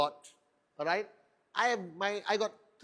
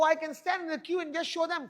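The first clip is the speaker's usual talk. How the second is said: ordinary talk, nothing marked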